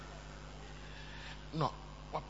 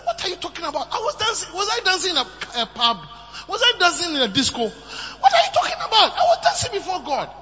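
high, loud, laughing outrage; husband fires back at his scornful wife; indignant retort; 8 semitones higher, 6 dB louder than usual. Retold or imitated. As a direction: fire it back in disbelief. David retorts angrily, laughing.

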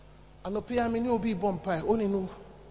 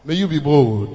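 loud, low-pitched pronouncement; booming low benediction; proclaimed blessing; 4 semitones lower, 11 dB louder than usual. Real, not acted. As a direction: declare it over the congregation with force. Blessing proclaimed over congregation.

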